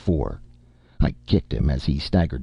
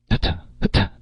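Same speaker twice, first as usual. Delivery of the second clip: a sneeze voiced as hutta hutta; a spelt-out sneeze; mock sneeze; 2 semitones higher, faster than usual. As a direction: sneeze it out in two bursts. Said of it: "Did you just sneeze?".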